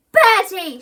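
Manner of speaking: loud, high shout. A narrator's reading. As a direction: shout his name. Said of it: "shouted Mum".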